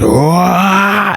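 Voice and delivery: mock high "waah" of panic; comic high-pitched wail; freaked-out wail. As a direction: imitate someone freaking out with a drawn-out cry. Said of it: "literally freaking the f".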